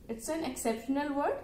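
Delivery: usual speaking voice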